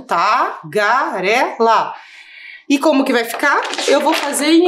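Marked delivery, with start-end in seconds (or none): 0.0-1.9 tagarela pulled apart syllable by syllable, very slow; slow syllable split. Shown as a demonstration. Teacher showing the class the split, very slowly. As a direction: stretch out tagarela to show the split. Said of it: "tagarela vai separar em".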